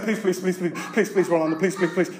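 soft, low stream of pleases; hushed mock panic; panicked pleading. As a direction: repeat it helplessly like a terrified kid. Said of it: "my brother panicked".

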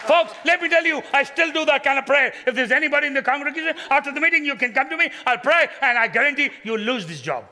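high, loud, booming joke to the crowd; full-force preaching. Acted. Pastor jokingly offers the congregation a prayer, preaching loudly. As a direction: preach it big and loud, grinning.

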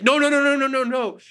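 high, panicked repeated no; pleading no. Retold or imitated. David pleads with God to spare child, panicked pleading. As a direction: beg desperately like a guilty king.

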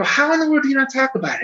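high, quick incredulous question. Retold or imitated. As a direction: retell it in baffled disbelief. Quoting own incredulous question to interviewees.

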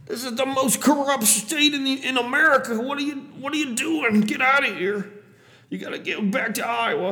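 high-pitched cabbie rant; hammed-up, high-pitched driver impression; bellowing cab driver. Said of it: "immediately bellows out".

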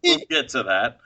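breaking into laughter mid-sentence; giggling through words; laughing. Real, not acted. Laughing about weird videos.

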